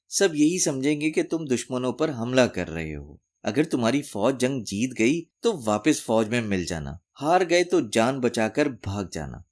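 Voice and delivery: ordinary delivery, nothing marked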